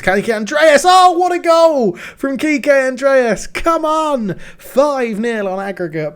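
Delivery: long drawn-out goal scream, high and loud; long goal yell. Real, not acted. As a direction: hold the goal yell out as long as you can. Right back scores a goal.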